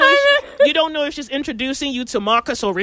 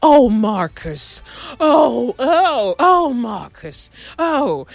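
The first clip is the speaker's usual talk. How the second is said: swooning wife; 4 semitones higher, much slower than usual. Retold or imitated. Mocking the wife's swooning.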